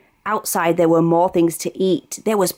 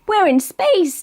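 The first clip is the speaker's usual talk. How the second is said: yelled; 10 semitones higher than usual. A narrator's reading. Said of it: "Danny yelled".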